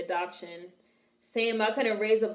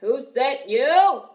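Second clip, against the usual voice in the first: mock menace; 4 semitones higher, 8 dB louder, much slower than usual. Mock menacing reaction to movie.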